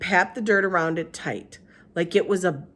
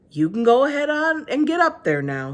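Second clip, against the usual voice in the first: preacher voice; 7 semitones higher, 6 dB louder than usual. Acted. He said to Winn-Dixie.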